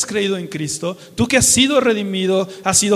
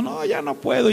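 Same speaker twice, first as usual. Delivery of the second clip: body complaining; 6 semitones higher than usual. Retold or imitated. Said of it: "tu cuerpo que te está gritando".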